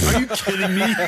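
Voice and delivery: mock outrage dissolving into laughter; laughing disbelief. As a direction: crack up while quoting the outrage. Host's outraged quote, laughing.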